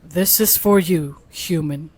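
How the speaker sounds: low, slow AI voice; Google Gemini voice. Acted. Reading the AI's message to a human, Google Gemini voice. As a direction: speak down low as a measured machine. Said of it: "Maghimo ka Google Gemini voice".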